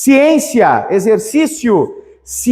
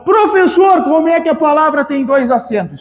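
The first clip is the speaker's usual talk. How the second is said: imitating a student; 8 semitones higher than usual. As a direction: play the confused student shouting a question.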